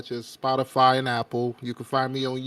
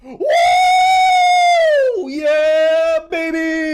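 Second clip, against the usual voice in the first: whooping way up high and loud; hype cheer; 7 dB louder, much slower than usual. Real, not acted. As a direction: let out a big celebratory whoop. Hype cheer for Sharingan return.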